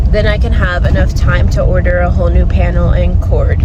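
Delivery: usual speaking voice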